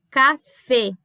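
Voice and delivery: "café" ending in closed ê, "cafê"; Spanish "café" with a closed final E. Shown as a demonstration. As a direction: say "café" the Spanish way, E kept narrow. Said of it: "Ele é sempre fechadinho".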